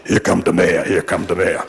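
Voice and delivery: low, muttered Amos and Andy accent, as a self-mocking joke; a lightly imitated Amos and Andy accent, voice dropped low; imitating Amos and Andy accent. New mayor Bradley joking about himself under his breath while rising to speak, in a low Amos and Andy accent. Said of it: "he comes off with this Amos and Andy accent".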